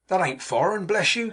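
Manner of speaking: cheerful cry. A narrator's reading. Mark teasing the hostess about his manners, crying out. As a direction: cry out in playful protest. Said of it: "cried Mark".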